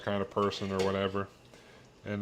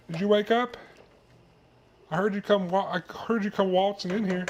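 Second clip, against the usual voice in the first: higher, sing-song pet voice; baby-talk to the dog; 10 semitones higher than usual. Acted. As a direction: coo at the little dog. Talking to his dog.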